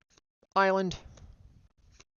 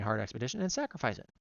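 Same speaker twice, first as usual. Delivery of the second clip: ordinary talk, nothing marked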